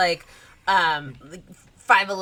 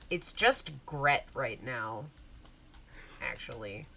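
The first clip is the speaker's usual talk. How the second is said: sullen Gret; 6 semitones lower, 9 dB quieter than usual. Acted. Gret smacks Hansel and corrects him.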